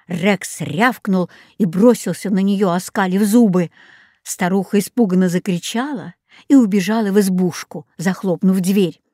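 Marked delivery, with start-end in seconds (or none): none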